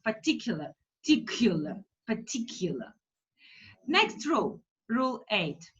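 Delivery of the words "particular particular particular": Particular with quick, weak first and last vowels; mouth barely open. Shown as a demonstration. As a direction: say Particular almost without opening the mouth. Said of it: "almost don't open my mouth".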